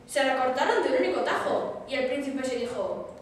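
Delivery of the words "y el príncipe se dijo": ordinary delivery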